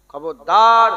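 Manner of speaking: a sudden loud warning; loud warning shout. Real, not acted. Preacher warning the congregation, shouting. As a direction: bark the warning out full force.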